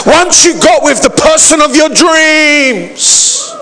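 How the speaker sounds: high-pitched, driving sermon build; impassioned preaching. Real, not acted. Preacher challenging church on life's achievements, impassioned. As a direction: pile up the list in a high, insistent voice.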